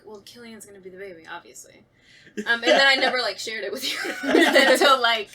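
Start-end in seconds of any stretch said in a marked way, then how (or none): 0.0-1.8 quick, soft inner voice; quiet smug inner thought; thinking aloud. Retold or imitated. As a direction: mutter it as a private thought. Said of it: "in my mind I was like".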